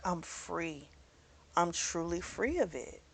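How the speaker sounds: softly voiced, mildly relieved quote; quiet relief. Heroine tells him after her house burned, in quiet relief.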